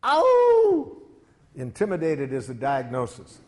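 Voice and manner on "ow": loud, high sustained "owww"; piercing held howl, far above speaking pitch; mock howl. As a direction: howl one long, high note as a playful alarm. Alarm at a wrong feeling word.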